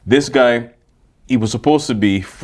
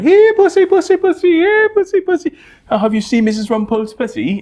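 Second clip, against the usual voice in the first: falsetto sitcom shopper. Retold or imitated. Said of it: "come in the store going".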